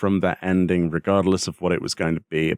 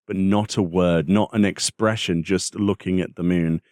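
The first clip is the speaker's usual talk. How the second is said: ordinary talk, nothing marked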